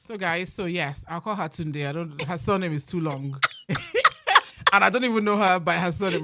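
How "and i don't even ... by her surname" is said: loud laugh then fast talk. Real, not acted. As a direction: laugh while explaining. Laughs at long surname.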